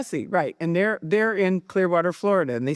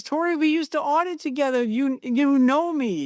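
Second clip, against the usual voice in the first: high, emphatic quoted plea, slowing at the end; insistent familiarity; 8 semitones higher than usual. Retold or imitated. Stacy phoning Tori as an old auditing colleague, emphatically familiar. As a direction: act out an old colleague urging trust, pitched high.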